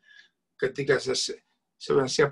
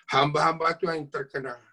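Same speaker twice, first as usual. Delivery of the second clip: usual speaking voice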